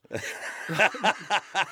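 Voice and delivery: amused laugh. Real, not acted.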